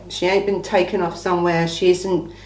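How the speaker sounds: ordinary talk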